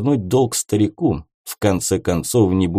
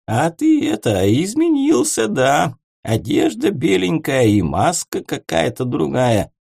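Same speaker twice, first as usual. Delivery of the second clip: put-on drunk, slurred call, higher pitch; tongue barely moving, calling out drunk; slurring drunk; 6 semitones higher than usual. A narrator's reading. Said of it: "еле ворочая языком".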